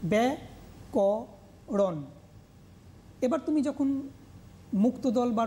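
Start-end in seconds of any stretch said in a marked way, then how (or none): 0.1-2.0 ব্যাকরণ very slowly as bya, ko, ron; three separate syllables in ব্যাকরণ, paused between. Shown as a demonstration. Teacher leading pupils to pronounce it, slowly separated. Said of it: "তুমিও নিজে উচ্চারণ করো".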